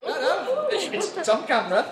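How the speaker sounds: laughing protest. Real, not acted. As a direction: laugh through the denial.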